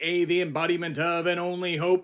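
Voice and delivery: loud, high announcer shout. Acted. Host shouting his segment intro at America, loud and high. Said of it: "here shouting at you today".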